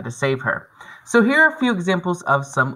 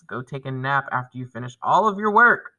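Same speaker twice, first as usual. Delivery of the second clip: normal talking voice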